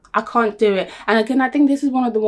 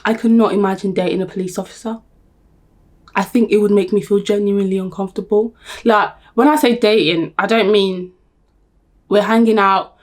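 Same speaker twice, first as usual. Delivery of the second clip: ordinary delivery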